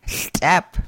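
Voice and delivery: "step" with a slurpy sideways l; slurpy barred l. Shown as a demonstration. Teacher modelling barred L for learners, slurpy l. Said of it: "Barred L pronounced as a slurpy L".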